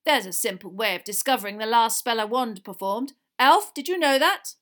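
loud, pressing threat, rising at the question; menacing official. A narrator's reading. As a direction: lean on the accused. Diggory threatens the elf.

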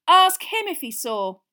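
slow, high, loud demand; insistent. Acted. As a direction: press the point slowly. Ron insisting.

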